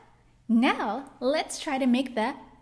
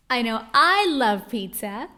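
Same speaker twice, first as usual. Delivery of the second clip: pizza enthusiasm; 8 dB louder than usual. Acted. Teacher hyping pizza game.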